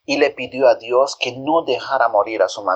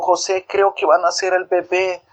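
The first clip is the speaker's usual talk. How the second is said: mother's voice; 6 semitones higher than usual. A narrator's reading.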